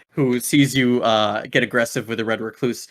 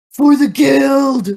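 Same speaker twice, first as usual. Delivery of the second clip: high, slow roaring war cry; battle cry; 11 dB louder, much slower than usual. Acted. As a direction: bellow it as you charge in. Thrall charges with battle cry.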